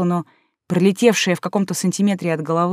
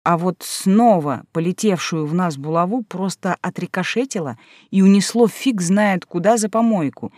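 ordinary delivery, nothing marked

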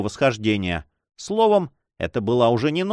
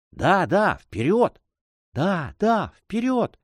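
ordinary delivery, nothing marked

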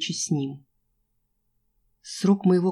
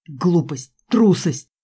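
shouted word by word, louder; angry boss shout; 5 dB louder, much slower than usual. A narrator's reading. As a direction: bark each word at her. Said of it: "Босс кричал". (разгневанный крик босса)

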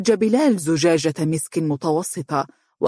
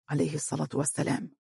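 murmured blessing, much softer and quicker; muttering; 11 dB quieter, faster than usual. A narrator's reading. Bilal echoing the blessing after the father, muttered. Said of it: "تمتم بلال".

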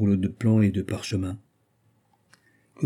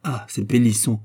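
ordinary delivery, nothing marked

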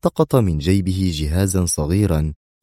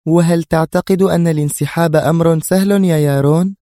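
much higher and louder, hard threatening question; very stern Sonia; 11 semitones higher, 5 dB louder than usual. Acted. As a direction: Sonia presses Yaron, sharp and menacing. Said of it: "قالت سونيا في صرامة شديدة".

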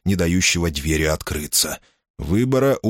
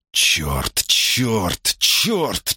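muttering curses; 5 semitones higher, much slower than usual. Acted. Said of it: "бормотал себе под нос".

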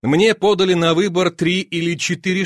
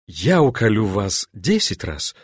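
ordinary delivery

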